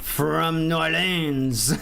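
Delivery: hammed-up French accent, very drawn out. Streamer claiming a home city, slow mock French accent. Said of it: "French accent".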